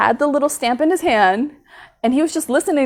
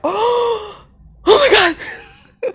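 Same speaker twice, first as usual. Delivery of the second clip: gasping oh my God; 11 semitones higher, 6 dB louder, much slower than usual. Real, not acted. Relief after passing immigration.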